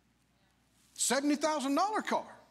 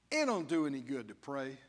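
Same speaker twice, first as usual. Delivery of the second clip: mock doubter; 7 semitones lower than usual. Preacher mocking a discouraged believer, low sulky grumble. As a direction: mimic a sulky churchgoer who gave up.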